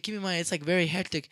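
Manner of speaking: normal talking voice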